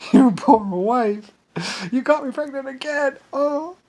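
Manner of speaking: mock wife complaining. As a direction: whine like the pregnant wife. Voices the wife complaining, laughing.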